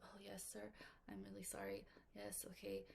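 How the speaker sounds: meek whispered apology on the phone; whisper, cowed by a rude customer; timid apology. Pretend. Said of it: "you're like".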